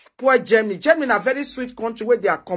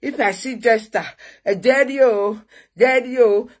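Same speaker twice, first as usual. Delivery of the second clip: mocking the rival. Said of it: "they tell you".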